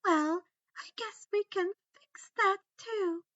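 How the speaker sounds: high, loud, sugary menace; cruel sweet taunt. A narrator's reading. Umbridge taunting wounded Harry, sugary and cruel. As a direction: coo the threat with relish.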